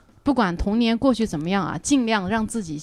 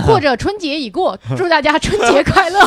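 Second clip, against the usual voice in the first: bright, higher festive greeting; cheerful new year wishes; 6 semitones higher, 6 dB louder than usual. Real, not acted. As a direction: beam the holiday wish at listeners. Holiday greeting, louder and higher.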